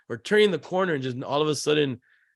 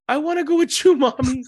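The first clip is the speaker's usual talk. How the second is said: put-on high, pleading little-kid voice; little girl voice; 14 semitones higher, 8 dB louder than usual. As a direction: beg like a small daughter. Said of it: "She goes".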